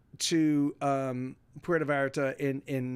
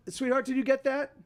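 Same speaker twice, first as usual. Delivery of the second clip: higher-pitched aside to someone nearby; calling to partner; 7 semitones higher than usual. Real, not acted. Streamer asking partner about the doorbell, higher-pitched call. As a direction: break off and ask sweetly upward.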